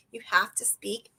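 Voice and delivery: whispered like a secret tip. Real, not acted. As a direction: lean in and whisper it. Confiding key point.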